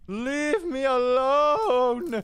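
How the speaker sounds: very high laughing shriek into a drawn-out plea. Real, not acted. Gamer fleeing a mob, shrieking for mercy. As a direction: shriek and stretch leave me alone.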